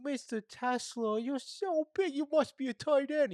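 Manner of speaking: high-pitched gushing admirer. Acted. Fan gushes to Mister Tesla about his size, high and gushing.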